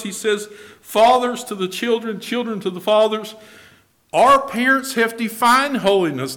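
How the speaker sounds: loud, high-pitched sermon delivery; full-force preaching. Real, not acted. Preacher telling the congregation how parents defined holiness, preaching at full force.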